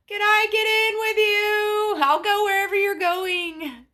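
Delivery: hammed-up begging called after someone; mock eager calling. Fan calling after singer's car, hammed-up eager plea.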